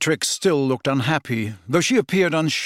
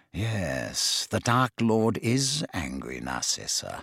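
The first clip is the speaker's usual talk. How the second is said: low warning; 8 semitones lower, 7 dB quieter, slower than usual. A narrator's reading. Snape's grave warning.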